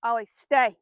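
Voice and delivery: loud, firm stay command; a real firm command to the dog. Real, not acted. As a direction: hold the dog in place with the voice. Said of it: "in a pretty firm voice".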